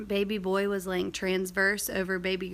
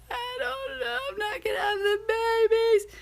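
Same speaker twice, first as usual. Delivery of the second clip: very high, sobbing, breaking voice; imitated crying. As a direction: replay yourself crying on the phone. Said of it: "like, crying".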